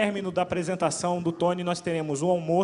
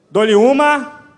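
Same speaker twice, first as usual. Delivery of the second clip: loud, high auctioneer countdown; going once, going twice, auction style; 5 semitones higher, 17 dB louder, much slower than usual. Pretend.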